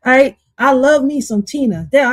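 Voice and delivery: ordinary delivery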